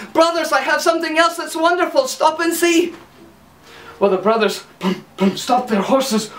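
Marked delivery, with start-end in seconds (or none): 0.1-2.9 high excited call; Hans calling. Acted. Silly Hans calls high to his brothers, excited. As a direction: shout high to make them stop.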